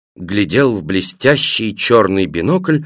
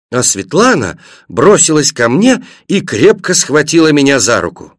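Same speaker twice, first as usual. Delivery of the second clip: ordinary delivery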